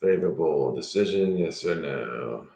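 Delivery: low and hushed, slowed right down; hushed question. Real, not acted. Reader quietly asks the cards about the decision, hushed. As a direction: ask it softly, as if putting the question to the cards.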